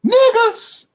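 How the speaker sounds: very high, loud yell; screamed exclamation. Acted. Streamer yelling the word for shock. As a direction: scream it out in a strained falsetto. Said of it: "I'm gonna say it like this".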